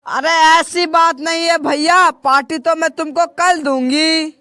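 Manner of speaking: ordinary delivery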